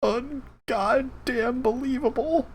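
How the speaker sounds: slow, high, deflated disbelief; exasperated. Real, not acted. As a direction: drag it out, fed up. Game crashed again.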